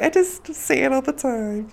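high-pitched mimicking voice; mimicking co-host. Imitating co-host answering the mother, high mock voice. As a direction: parrot her in a high little voice.

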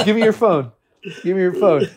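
insist, hand out for the phone; demanding friend. Retold or imitated. Friend grabbing his phone, loud demand. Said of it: "he goes".

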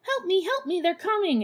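high-pitched pretend panic; mock freaking out. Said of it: "Mary Wood's all freaking out".

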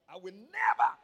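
never shouted high and loud, punched out; hammered confession. Shown as a demonstration. Pastor showing the church how he hammers the confession, shouting. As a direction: hammer the word never home. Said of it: "I used to hammer that point".

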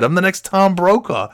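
high, hammed-up cocky brag; mock boasting. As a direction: brag absurdly to rile a rival. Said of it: "I'm shit-talking him back".

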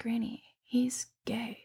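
slow secret whisper. Retold or imitated. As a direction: whisper it like a confession.

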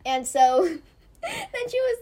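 normal talking voice

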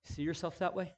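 ordinary delivery

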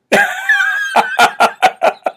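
high, helpless giggling; real burst of high laughter; laughing hard. Real, not acted. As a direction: crack up at your own joke. Cracks up at own garbled impression.

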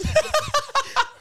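high, loud burst of laughter. Real, not acted. Storyteller cracking up at his own tale, high laughter. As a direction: crack up loudly.

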